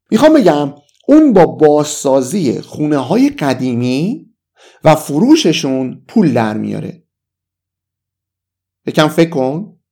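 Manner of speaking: ordinary delivery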